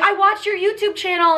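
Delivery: high, eager imitated fan; excited fan. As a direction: act out an admirer's excitement. Fans greeting her, acted out.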